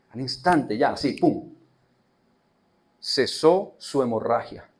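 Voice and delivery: ordinary delivery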